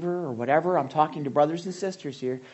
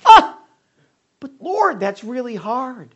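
loud, much higher anguished cry of complaint; whiny protest; 8 semitones higher, 11 dB louder, slower than usual. Acted. Preacher acting a believer protesting to the Lord, loud whine.

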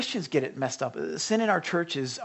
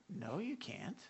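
ordinary delivery

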